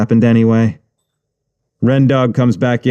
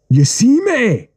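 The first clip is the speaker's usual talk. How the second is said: menacing Jamaican; 13 semitones higher, slower than usual. Acted. Gangster threatening again.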